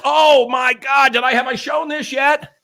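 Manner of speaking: high-pitched, drawn-out excitement; slow squeal of show-and-tell delight; excited. Real, not acted. Host showing a gift to viewers, excited and high.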